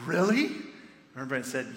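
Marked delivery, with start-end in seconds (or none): 0.1-0.6 high, rising disbelieving question; acted surprise, pitched up; astonished hope. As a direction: ask it as if hardly daring to believe. Tearful guard hears of resurrection.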